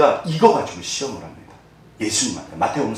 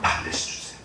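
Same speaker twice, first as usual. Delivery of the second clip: hushed 반드시; 13 dB quieter than usual. Shown as a demonstration. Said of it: "이게 약속이에요".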